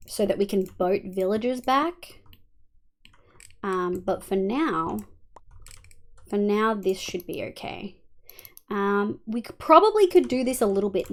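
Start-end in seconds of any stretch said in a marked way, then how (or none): none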